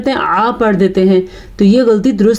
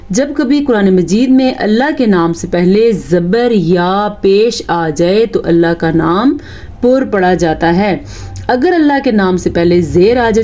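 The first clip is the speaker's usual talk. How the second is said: ordinary delivery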